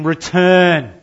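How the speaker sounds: high, loud divine summons; a booming plea to return; God's call. Acted. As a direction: call the nation home with a loud cry. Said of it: "He's saying".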